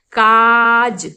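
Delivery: काज with the क stressed, mouth wide open; काज, stretched aa, stress on क; open-mouth demo. Shown as a demonstration. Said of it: "काफी stress देकर मुंह open करके बोला".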